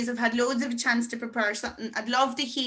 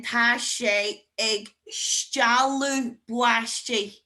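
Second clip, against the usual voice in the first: very slow, word by word up to STEALÚ BUAISTE; drawn-out teacher's model; slow demonstration; much slower than usual. Shown as a demonstration. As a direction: stretch the combined phrase for learners. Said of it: "You can combine them".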